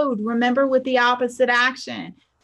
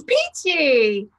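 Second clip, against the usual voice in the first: higher, punchy, cheery one-word answer; perky peachy; 4 semitones higher than usual. Retold or imitated. Bank teller answering an old customer's how are you, perky. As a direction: sing it out brightly with pep. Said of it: "something like, "Peachy".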